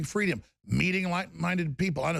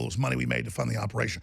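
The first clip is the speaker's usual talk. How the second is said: ordinary talk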